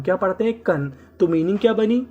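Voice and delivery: ordinary talk, nothing marked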